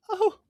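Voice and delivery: very high, small "oh"; high-pitched coo of sympathy; pitying "oh". Retold or imitated. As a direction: let out a tiny heartbroken squeak. Acts out pitying reaction to crying.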